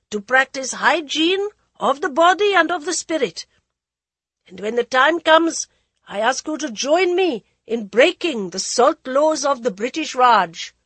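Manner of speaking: high, loud speech to a crowd; high goading voice. Acted. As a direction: rouse the crowd, high-pitched. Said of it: "The high voice continued to goad them".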